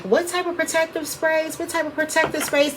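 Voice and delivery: high, soft questioning imitation; lightly imitating curious followers; mock asking voice. Reader mimicking viewers who keep asking about sprays, high and soft. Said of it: "A lot of people be asking".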